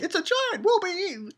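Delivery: very high, put-on frightened squeal; mock panic. Villager seeing a giant appear, mock panic. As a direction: cry out in hammed-up terror.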